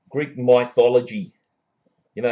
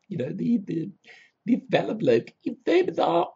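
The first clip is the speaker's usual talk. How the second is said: high comic voice; 10 semitones higher than usual. Acted. Comic persona reacting to bizarre show.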